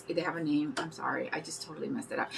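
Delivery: quiet unvoiced whisper; hushed aside to the viewers. Real, not acted. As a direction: drop to a secret whisper. Whispered aside about product name.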